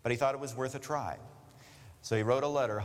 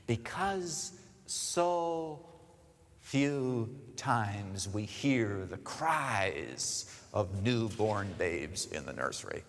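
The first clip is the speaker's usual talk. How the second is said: ordinary talk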